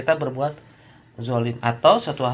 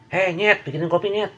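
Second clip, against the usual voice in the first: higher and louder, barking orders disrespectfully; rude boss; 8 semitones higher, 5 dB louder than usual. Acted. Boss ordering worker to make coffee, rude higher call.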